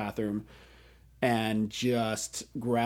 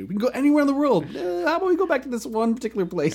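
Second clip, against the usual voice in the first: high, fast mock parent voice; imitating parents in a high, quick voice; 13 semitones higher, 5 dB louder, faster than usual. Parents mocked going to timeshare.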